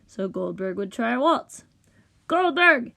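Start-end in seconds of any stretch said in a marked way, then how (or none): 2.3-2.9 high, loud reproach; annoyed shout. A narrator's reading. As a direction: call the name in exasperation. Rich dude calls out in annoyance.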